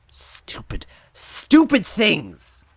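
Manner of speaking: breathy stupid then a huge high scream; stupid hissed, stupid things! screamed slow and loud; furious outburst. Acted. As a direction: explode with grief and anger. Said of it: "at her outburst".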